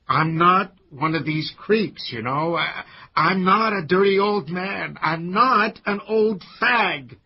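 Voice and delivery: loud, agitated, climbing protest; vehement denial. Acted. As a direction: hammer each denial harder, slowing on the last.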